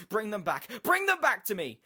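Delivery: loud, high-pitched desperate demand; desperate shouting. A narrator's reading. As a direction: scream it at the screen. Desperate man demands his family back.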